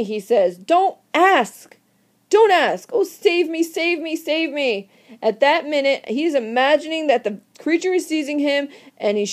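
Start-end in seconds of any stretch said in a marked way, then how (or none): 0.6-4.9 terrified plea. Acted. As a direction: cry out as if seized by the monster. Victor imagines creature seizing him.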